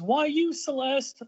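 high, swooping mock wail; mock sob. Child Kent mourns dead Celeste, mock sobbing wail. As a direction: wail it in exaggerated grief.

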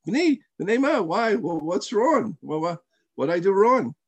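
high-pitched, gentle plea; unangered, innocent puzzlement. Retold or imitated. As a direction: ask what went wrong, imitating a hurt-free sage. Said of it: "He must have gotten angry. No".

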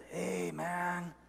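soft, casual tempting voice; devil on the shoulder. Acted. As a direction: quietly talk them out of it. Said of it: "then the second voice chimes in".

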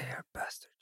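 whispered, playful complaint. Real, not acted. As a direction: breathe it out under your breath. Muttered reaction to roll.

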